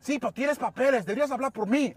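imitating an insistent coworker, high and loud; heated demand. Coworker's side of acted quarrel.

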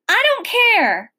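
loud, high outburst; high, upset shout; holler. A narrator's reading. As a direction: holler it back defiantly. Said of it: "I hollered".

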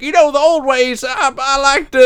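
high put-on country-guy voice. Retelling the show, aping a conservative trucker, high and loud.

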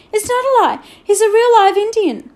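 high, loud outburst; sudden shout. A narrator's reading. Boy protests to the headmaster, shouting suddenly. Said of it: "Patrick shouted suddenly".

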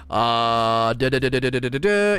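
low, monotone da-da-da; scanning filler. Real, not acted. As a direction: rattle nonsense syllables while searching the text. Filler while scanning a blog post.

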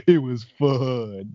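drawn-out, put-on movie character voice; goofy movie-killer voice. Host quoting the killer's line to co-hosts, goofy drawn-out voice. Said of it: "What was that voice?".

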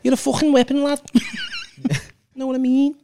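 high, hammed-up Scouse lad voice. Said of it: "It's such a stereotypical thing, that".

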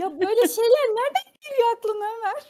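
high, loud swooning gush; admiring girl voice. Pretend. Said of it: "Deyip kız daha çok etkileniyor".

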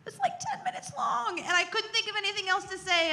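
high, squeaky through laughter; laughing. Real, not acted. Confessing to the group her prayer lasted minutes, laughing. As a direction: tell it through a rising giggle.

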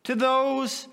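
high, loud, slow, on the edge of tears; impassioned preaching. Acted. Preacher overwhelmed by God's love, voice breaking.

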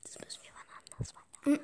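soft breathy whisper. Real, not acted. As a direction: whisper it aside. Leises Beenden der Aufnahme. (geflüstert)

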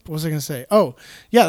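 ordinary talk

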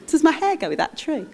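mock-fretting over her looks; vain woman. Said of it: "she'd be like".

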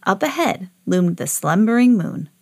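ordinary delivery

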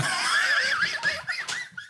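a very high, squealing burst of laughter; shrieking laugh. Real, not acted. Laughing hard at his own squash joke, high shriek.

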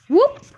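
high, loud sudden whoop; whooshing whoop. A narrator's reading. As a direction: let out a sharp rising whoop. Boy shoots up the pipe.

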